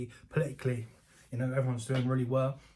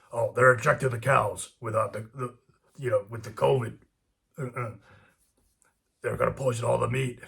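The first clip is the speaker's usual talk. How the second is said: Alex Jones; 6 dB louder than usual. Retold or imitated. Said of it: "listen to Alex Jones".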